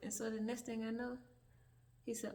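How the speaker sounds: normal talking voice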